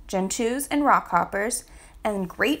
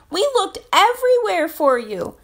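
relieved mother; 9 semitones higher, 6 dB louder than usual. A narrator's reading. Mother penguin finds lost chick.

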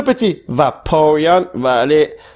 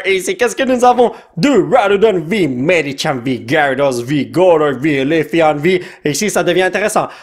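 usual speaking voice

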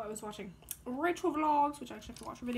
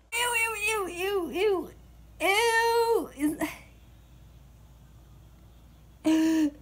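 disgusted squeal; 9 semitones higher, 4 dB louder, much slower than usual. Real, not acted.

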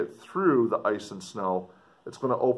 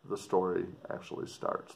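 plain reading voice

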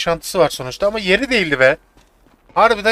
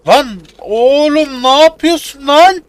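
slow, loud, higher drawn-out yell; 8 semitones higher, 8 dB louder, much slower than usual. Real, not acted. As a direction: stretch it out and yell it. Yelling at the dog in game.

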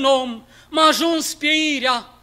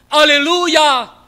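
louder, slow exultant Aleluia; triumphant; 7 dB louder, slower than usual. Acted. Reciter hailing Christ's victory over death, slow triumphant shout. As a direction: proclaim the victory.

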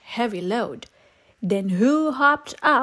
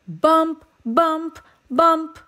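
bumpy bump sounds; 6 semitones higher, 3 dB louder, slower than usual. A narrator's reading. Truck bouncing along.

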